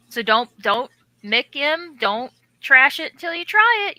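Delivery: building from firm to a loud, high challenge; calling out. Real, not acted. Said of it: "calling out to viewer".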